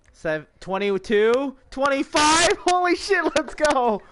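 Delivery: high, loud rising excitement; excited counting. Real, not acted. Counting players joining the race.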